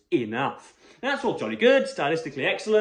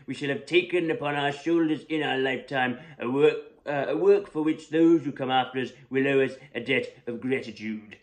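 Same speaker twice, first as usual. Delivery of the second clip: Winston Churchill impression. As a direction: ham up a grave Churchillian speech, knowingly badly. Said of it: "awful, uh, impression of Winston Churchill".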